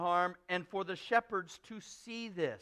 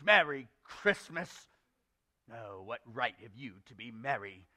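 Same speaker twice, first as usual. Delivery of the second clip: grumbling old uncle's put-on voice; Scrooge; 6 semitones lower than usual. Uncle scoffs at nephew's right to be merry, grumbling. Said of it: "said Scrooge".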